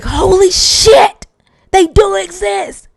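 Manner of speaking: slow, high, loud drawn-out amazement; stunned exclamation. Retold or imitated. Investor marvelling on the phone, slow loud amazement. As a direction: stretch it out in mock awe.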